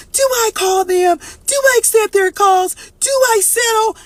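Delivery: high, slow, whiny questioning; hammed-up high whine of doubt; mock indecisive wail. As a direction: play the torn ex-lover begging herself. Imitating indecisive listener.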